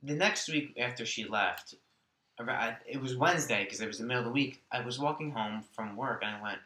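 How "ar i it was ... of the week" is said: ordinary talk, nothing marked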